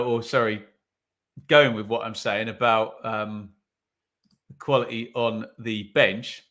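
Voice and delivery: normal talking voice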